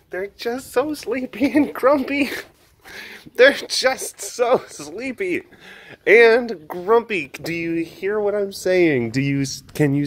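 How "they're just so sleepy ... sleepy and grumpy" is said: gushing. Real, not acted. Gushing over sleepy, grumpy little guys, high and adoring.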